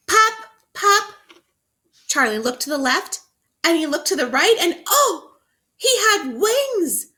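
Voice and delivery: high, loud, thrilled read-aloud; excited reading. Shown as a demonstration. Teacher reading to children, thrilled high read-aloud. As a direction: burst out with delight at the reveal. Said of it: "The author wants you to be so excited".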